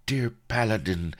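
high, lightly voiced lovelorn lady; mock-tender feminine address; lady's endearment. A narrator's reading. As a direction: coo it as a quoted sweetheart. Blood quotes the lady's endearment.

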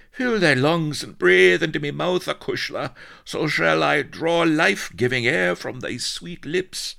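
high, florid, desperate plea; melodramatic Irish gallant. A narrator's reading. Drowning colonel wooing Vanity for air, melodramatic plea. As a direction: declaim it passionately, swelling loud.

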